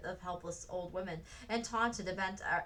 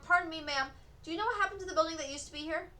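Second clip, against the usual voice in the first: raised, higher call to a window; 8 semitones higher, faster than usual. A narrator's reading. Frances calling up to the woman, polite raised call. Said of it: "Frances called to her".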